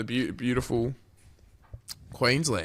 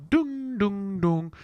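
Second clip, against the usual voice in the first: sung airport ding-dong, high; imitating the boarding chime; chime imitation; 8 semitones higher, much slower than usual. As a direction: mimic the PA chime tones.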